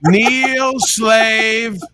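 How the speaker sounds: high commanding villain shout; booming echoing villain monologue. Acted. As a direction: order them down like a tyrant. King commands slave to kneel.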